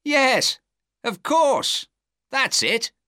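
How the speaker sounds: very high, eager exclamations; thrilled detective piecing it together; excited cry. A narrator's reading. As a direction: exclaim in bursts of sudden realisation. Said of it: "he cried".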